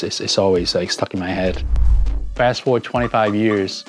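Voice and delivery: ordinary talk, nothing marked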